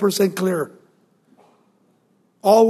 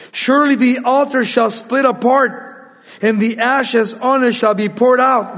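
raised proclaiming read; preaching; 5 semitones higher, 3 dB louder than usual. Acted. Preacher reading the altar sign to the congregation, proclaiming. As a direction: declare the sign boldly.

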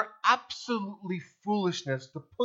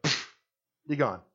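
arrogant dismissal; 6 semitones lower, 3 dB quieter than usual. Retold or imitated. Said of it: "To which in my arrogance".

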